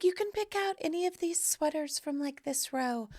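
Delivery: high and soft, kindly offering; lightly voiced sweet hostess; Janet's voice. Janet lets her guest pick any sweater, high and soft. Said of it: "she's like".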